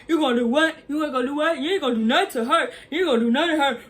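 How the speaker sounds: a hammed-up hype-man boyfriend squaring up; mocking the boyfriend. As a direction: ham up his rowdy taunting. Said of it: "come in the house running like".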